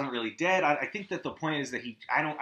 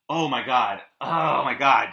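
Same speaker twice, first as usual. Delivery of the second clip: groaned oh my God, louder each time; appalled outburst at the scene; loud dismay; 2 semitones lower, 7 dB louder than usual. Real, not acted. Reacting to horror scene.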